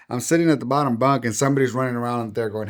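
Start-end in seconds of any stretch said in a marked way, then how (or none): none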